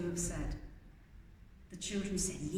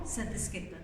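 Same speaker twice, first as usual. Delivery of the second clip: usual speaking voice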